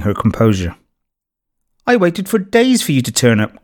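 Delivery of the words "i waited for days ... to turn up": cried out. A narrator's reading. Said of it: "cried Hannah".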